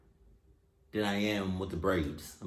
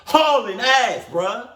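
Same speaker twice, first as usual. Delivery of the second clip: loud, high-pitched heckle, stretching the last words; loud mocking outburst; 9 semitones higher, 8 dB louder than usual. Reacting to a horror story about following screams, loud mocking outburst. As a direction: exclaim it high and loud in disbelief.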